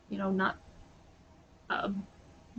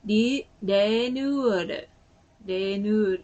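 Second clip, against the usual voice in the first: slow, loud "rinyuuaru" with tapped r; Japanese pronunciation; 5 dB louder, slower than usual. Shown as a demonstration. Showing the word they say, in Japanese pronunciation. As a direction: say renewal with Japanese r sounds and added vowels. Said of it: "and they say".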